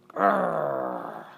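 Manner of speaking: short hamster growl of annoyance; frustrated growl. Acted. Hamster fuming at the teacher, mock growl. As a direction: growl like a cross little pet.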